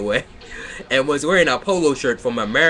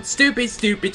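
"stupid, stupid" said much higher and sharp in real exasperation; frustrated outburst; 8 semitones higher than usual. Real, not acted. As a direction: let out "stupid" high, fed up with the text.

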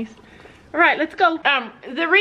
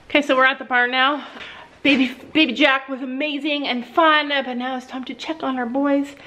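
ordinary talk, nothing marked

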